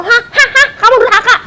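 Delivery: high-pitched mocking stammer; mock scolding. Acting out someone telling a wife she is sinful, high mock scolding. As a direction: imitate a huffy scolding in a high comic voice. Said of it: "Atau ngomong".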